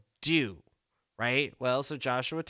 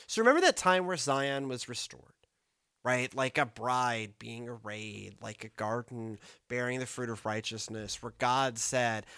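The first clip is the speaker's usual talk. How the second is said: normal talking voice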